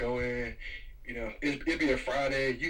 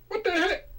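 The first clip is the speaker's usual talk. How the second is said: high, incredulous what the heck; baffled. Retold or imitated. Shopper baffled by a rude cashier, high disbelief. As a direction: squeak it out in disbelief.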